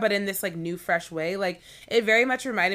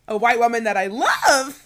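loud, high, adoring build-up; excited, emphatic fan reveal; gushing; 4 semitones higher, 10 dB louder than usual. Real, not acted. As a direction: gush loudly over a favourite. Gushing introduction of a favourite star.

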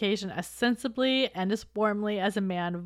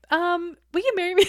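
mock proposal; 9 semitones higher, 5 dB louder than usual. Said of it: "Elizabeth was ready to be like".

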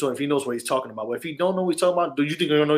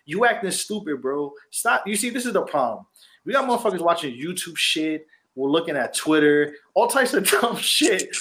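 ordinary delivery

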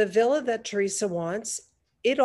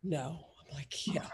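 very soft, low, near whisper; stunned hush; 5 semitones lower, 13 dB quieter than usual. Retold or imitated. Friend handed the phone cannot believe it, hushed. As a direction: barely voice it, in mock disbelief. Retells stunned disbelief at offer.

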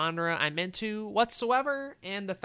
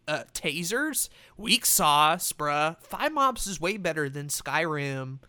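loud laughing gamer hype, drawn out; hyped gamer bro; 3 semitones higher, 4 dB louder than usual. Pretend. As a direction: ham up the gamer bro countdown. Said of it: "let me do like a gamer voice".

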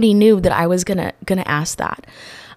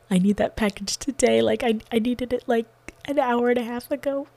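high, soft, teary pleading; imitating herself sobbing at the counter; retold crying plea; 6 semitones higher, 5 dB quieter than usual. To the store clerk, a high, soft, teary plea. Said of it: "I start crying in the U P S store".

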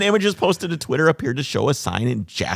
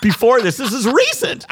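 excited; 12 semitones higher, 4 dB louder than usual. Pretend. Moose story told in voice.

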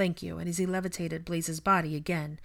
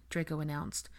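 ordinary delivery

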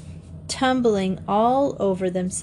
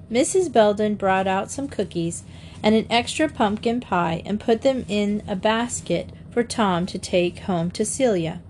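plain reading voice

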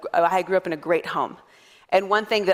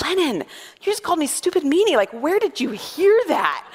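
higher, incredulous parent, slowing at the end; mock-shocked mom; 7 semitones higher than usual. Mom to two-year-old who called her stupid meanie, mildly shocked. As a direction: question the child in disbelief. Mother questioning her toddler.

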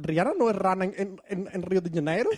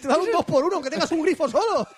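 mock ad pitch; 6 semitones higher than usual.

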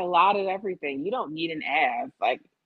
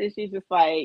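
normal talking voice

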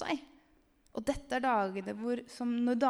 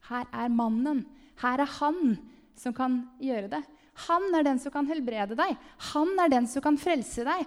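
loud, raised proclamation; calling out; 3 semitones higher, 6 dB louder than usual. Retold or imitated. Proclaiming Jesus to the people as healer and saviour, called out loud. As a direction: cry it out, hammering home each claim. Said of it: "og rope ut".

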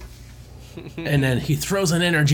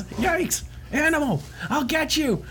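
much higher, campy Samus voice; hammed-up heroine; 12 semitones higher, slower than usual. Host reading Samus's comic line in a campy high voice. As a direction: ham up the heroine's threat.

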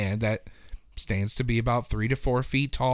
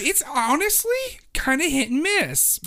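giddy; 12 semitones higher than usual. Real, not acted. Still giddy.

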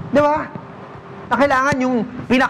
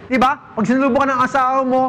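ordinary delivery

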